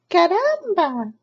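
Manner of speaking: high, slow, loud chicken exclamation; chicken crying out. Acted. Chicken exclaims on finding the nest, high and loud. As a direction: squawk it like a startled hen. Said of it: "she cried".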